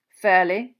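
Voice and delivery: dry, understated one-word answer. A narrator's reading. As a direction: answer curtly, no lift in pitch. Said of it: "said Mister Crouch dryly".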